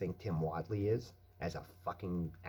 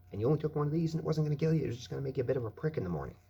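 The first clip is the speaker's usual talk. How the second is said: higher, louder and fast, words tumbling out; heated fast rant; 8 semitones higher, 6 dB louder, much faster than usual. Real, not acted. Remembering the old rave days, fast heated rant. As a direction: rush it out, worked up.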